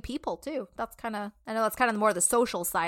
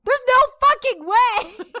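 high, loud and slow, shrieking through laughter; laughing disbelief; 10 dB louder, slower than usual. Real, not acted. Hearing her husband guess ten soggy Oreos, shrieking in disbelief. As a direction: scream it out in amused disbelief.

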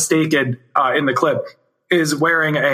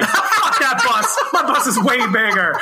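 mock boastful rival; 5 semitones higher than usual. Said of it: "somebody out there that's like".

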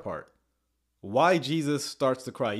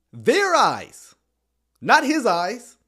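"their" and "his" hit higher and louder; contrastive stress; 7 semitones higher, 5 dB louder, much slower than usual. Real, not acted. Host reading scripture, punching "their" versus "his". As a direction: lean hard on "their" against "his".

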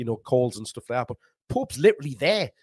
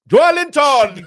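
booming call of the name, high and loud; stadium announcer; 12 semitones higher, 11 dB louder, much slower than usual. Real, not acted. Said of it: "you sound like the stadium announcer there".

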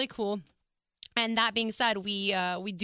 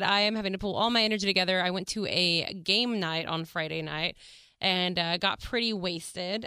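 ordinary talk, nothing marked

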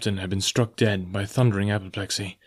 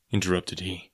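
ordinary delivery

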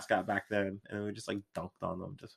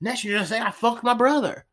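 higher, indignant yokel; 10 semitones higher, 3 dB louder than usual. Retold or imitated. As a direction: sputter it like a hillbilly. Continues the creationist impression.

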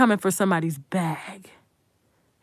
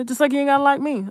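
usual speaking voice

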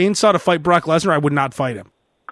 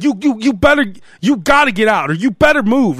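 mock aggressive; 4 semitones higher than usual. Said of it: "he was pretty aggressive".

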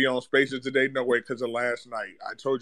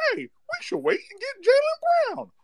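much higher, sing-song mock fan voice. Mocking the caller who wants to wait for Jaylen Brown, in a much higher mock voice. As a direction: squeak it out like the naive fan. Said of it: "talking about".

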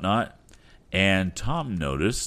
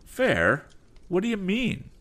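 put-on high young demanding voice; Tom demanding; 4 dB louder than usual. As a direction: press him hard for an answer. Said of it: "demanded Tom".

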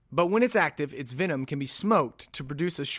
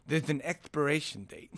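lisping boxer impression, pitch wandering; amateur lispy take on a famous boxer; Mike Tyson. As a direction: read the quote with a thick put-on lisp. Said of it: "my Mike Tyson impression".

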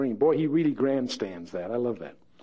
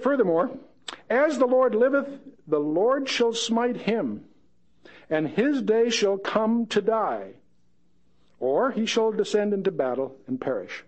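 raised, slow declaiming of the verse; dramatic scripture reading; 5 semitones higher than usual. Acted. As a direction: proclaim it like an old prophet. Reads scripture aloud.

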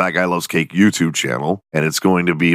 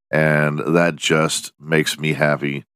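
ordinary talk, nothing marked

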